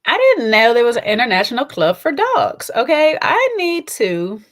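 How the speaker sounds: loud, high, amazed; excited disbelief. Real, not acted. Vlogger amazed that her dog goes clubbing, loud and excited. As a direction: blurt it out in disbelief. Surprised at dog club news.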